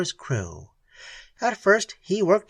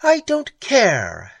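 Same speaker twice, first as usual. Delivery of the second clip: slow, louder, higher outburst; blazing defiance; 4 semitones higher, 6 dB louder, much slower than usual. A narrator's reading. Perry blazes at Keene's warning, defiant. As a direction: flare up hotly. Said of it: "she blazed".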